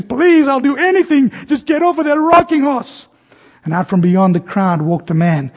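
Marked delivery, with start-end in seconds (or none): 0.0-3.1 high, desperate tearful plea; mother in tears begging her son to get off; tearful pleading. Retold or imitated. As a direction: beg through near-tears, pitch way up. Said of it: "this woman is in tears".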